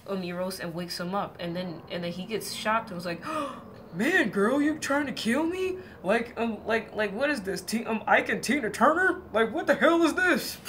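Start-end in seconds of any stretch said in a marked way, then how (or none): 3.3-3.9 sharp shocked intake of breath; a god jolted awake, gasping; startled gasp. Acted. Eros wakes burned by oil. 3.9-10.6 higher and louder, indignant and offended; outraged husband. Acted. Burned Eros confronts Psyche.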